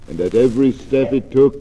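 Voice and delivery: ordinary delivery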